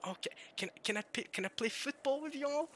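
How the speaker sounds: put-on high, hesitant little-kid request; pleading little kid. Youngest asking older siblings to play, high pleading voice. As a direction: ask timidly, climbing high at the end.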